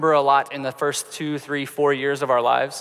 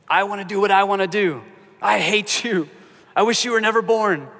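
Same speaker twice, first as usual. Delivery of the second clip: put-on whiny selfish kid shouting a bit higher; child's tantrum; 5 semitones higher than usual. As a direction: act out a spoiled kid lashing out.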